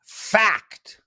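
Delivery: one punched, emphatic word; mock proclamation. Host reading a chat room comment, mock-emphatic. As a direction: announce it like an indisputable truth, mockingly.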